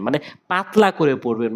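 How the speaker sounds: ordinary delivery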